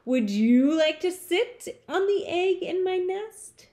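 high, rising coaxing question; wheedling bird voice, pitched high. A narrator's reading. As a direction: sweet-talk him into a favor. Lazy bird coaxes the elephant.

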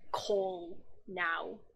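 ordinary delivery

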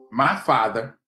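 ordinary talk

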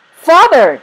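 high, loud cry of recognition; shouting. A narrator's reading. Lost son calling to his father in a crowd, shouting. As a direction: shout it out, pitch leaping up. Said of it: "John shouted".

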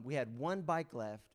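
ordinary talk, nothing marked

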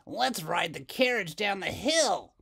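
put-on a bossy kid's idea; Doris. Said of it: "said Doris".

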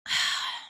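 long breathy exhale; weary sigh; heavy sigh. Real, not acted. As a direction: sigh it out before answering. Sigh after reading the story.

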